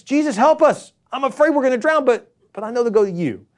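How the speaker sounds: high, loud desperate prayer; a scared disciple begging Jesus for help; frightened plea. Acted. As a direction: cry out to Jesus in fear, then settle into trust. Acted prayer in the storm.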